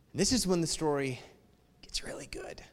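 soft secret whisper; whispered aside. Acted. Preacher whispering to the congregation that the good part comes. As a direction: lean in and whisper the tease. Teasing the story's climax.

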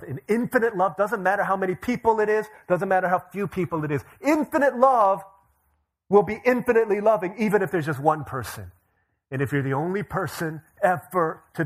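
loud, high, soaring preacher's cry; full-force preaching. Acted. Pastor telling the congregation about God's love, loud and soaring.

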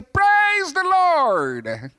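slow, high joyful church lady; slow, high mock church-lady praise; mother praising. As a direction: stretch it out like a rejoicing mother. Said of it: "I heard the lady's voice say".

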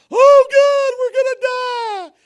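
very high, loud, slow cry of dread; acted repentant crowd howling in fear; terrified wail. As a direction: scream it out like you are about to die. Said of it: "Tears are flowing".

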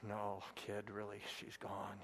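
gentle driver. Retold or imitated. Driver softly tells the boy his dog is dead, gentle. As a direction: break it gently to the kid. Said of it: "the guy's like".

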